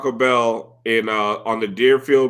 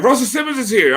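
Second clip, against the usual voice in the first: higher-pitched retold news; excited staff announcement; 8 semitones higher, faster than usual. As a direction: imitate coworkers announcing a celebrity. Staff announcing star's arrival.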